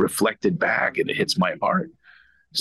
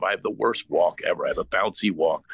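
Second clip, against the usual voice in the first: ordinary talk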